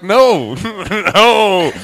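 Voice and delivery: high, loud, drawn-out "no"; hammed-up panicked objection; acted refusal. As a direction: cry out in mock protest. Imagines refusing the operation.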